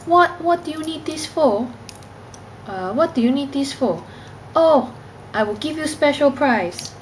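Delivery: put-on high singsong Cantonese-accented English. Imitating Hong Kongers from a television programme, Cantonese accent. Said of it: "they really speak like this".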